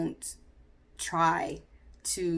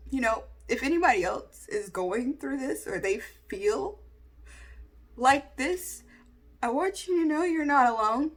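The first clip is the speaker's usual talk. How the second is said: tearful, earnest; 7 semitones higher than usual. Real, not acted. Emotional reassurance to viewers, raised pitch.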